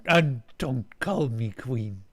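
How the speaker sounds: slow, firm alien order; the alien corrects his servant slowly and firmly; alien command. Acted. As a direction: lay down the rule slowly. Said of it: "again commanded".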